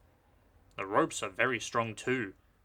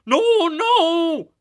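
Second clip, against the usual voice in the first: much higher, loud frightened wail; 16 dB louder than usual. A narrator's reading. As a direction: cry out in fear. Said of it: "wailed the empty trucks".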